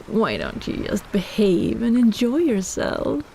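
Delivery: ordinary delivery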